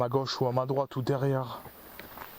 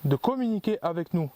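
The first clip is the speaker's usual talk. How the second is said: higher-pitched, measured questions with long pauses; calling out to spirits; 3 semitones higher than usual. Real, not acted. Ghost hunter asks the forest's witches to answer, calling out to spirits. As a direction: address unseen listeners, pitch lifted.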